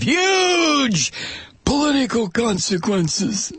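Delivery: drawn-out, high mock-ominous "huge"; mocking emphasis. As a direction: hammer the threat with mock drama. Mocking the quoted lobbyist's threat.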